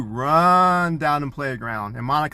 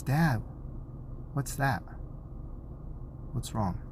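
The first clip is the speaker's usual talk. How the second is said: imitated quiet concern; 9 dB quieter than usual. Daughter's worried question, retold.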